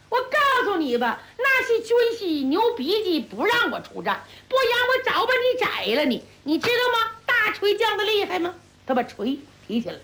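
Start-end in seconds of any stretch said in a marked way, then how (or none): 0.1-8.5 higher, boastful hothead bragging; brash young warrior. Acted. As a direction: brag like a reckless young general. 朱木英莽撞叫阵. (莽撞小将高声吹嘘)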